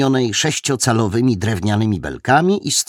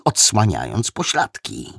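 ordinary delivery, nothing marked

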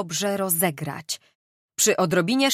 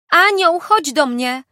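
shouting; 9 semitones higher, 7 dB louder than usual. Acted. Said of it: "Krzyknęła Gertruda".

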